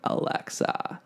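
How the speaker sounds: very low, slow, creepy growl; sinister gravelly command, drawn out; gravelly man's voice. Retold or imitated. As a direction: rasp it out slowly, deep in the throat. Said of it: "she heard a gravelly man's voice go".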